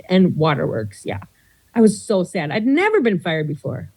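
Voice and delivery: normal talking voice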